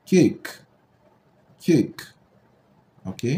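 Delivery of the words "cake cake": "cake" said higher, with alphabet-name A; long A in cake. Shown as a demonstration. Teacher showing why the first vowel says its name, "cake" as "kayk". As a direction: model cake crisply as "kayk", silent final e. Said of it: "nous prononçons ce mot comme".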